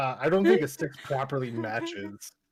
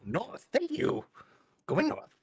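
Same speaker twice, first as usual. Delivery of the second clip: creature; 10 semitones higher than usual. Acted. Kobold replies to Sayo.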